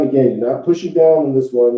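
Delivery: usual speaking voice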